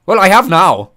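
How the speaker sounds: loud indignant snap; angry. Acted. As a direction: bark it back, offended. Angry henchman retort.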